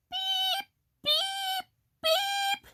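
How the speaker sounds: very high, thin horn beeps; distress beeps. A narrator's reading. Stuck truck calls the animals, high distress beeps. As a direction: beep high for help. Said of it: "cried the little blue truck".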